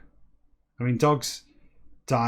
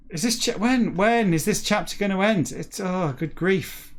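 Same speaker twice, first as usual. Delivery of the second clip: high, exasperated wail; 7 semitones higher than usual. Real, not acted. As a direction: groan in despair at an endless chapter. Fed up with endless chapter.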